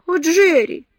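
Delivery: high, loud tearful cry of Джерри; tearful plea. A narrator's reading. She pleads, tearful. (жалобный возглас со слезами)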